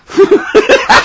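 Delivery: a high burst of laughter; laughing hard, high. Real, not acted. Laughing at the trifle story.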